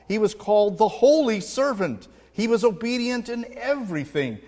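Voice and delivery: fervent sermon climax; preaching at full force. Acted. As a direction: drive it home from the pulpit.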